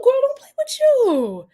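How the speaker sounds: delighted. Real, not acted. Delighted at South African viewer.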